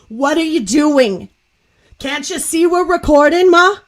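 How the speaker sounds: loud, high, exasperated daughter imitation; Leah Remini. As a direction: scold mother with drawn-out mock disbelief. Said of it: "I don't have the best Leah accent".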